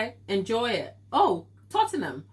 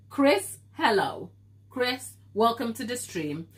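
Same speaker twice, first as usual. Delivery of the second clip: ordinary talk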